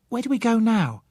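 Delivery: put-on a child asking in the pouring rain; eager little girl. Child Susan voiced in story.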